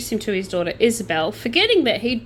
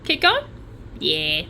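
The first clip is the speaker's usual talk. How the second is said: ordinary talk, nothing marked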